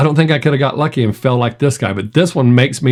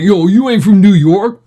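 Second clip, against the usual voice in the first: louder, higher New York accent imitating a drunk friend; brash New York accent, belted out a bit; 7 semitones higher, 5 dB louder than usual. Imitating friend Andy drunk, in a loud New York accent. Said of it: "he didn't have a New York accent until he got drunk".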